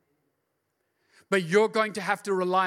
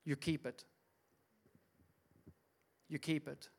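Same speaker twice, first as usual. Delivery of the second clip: quiet repeat; 3 semitones lower, 11 dB quieter than usual. Acted.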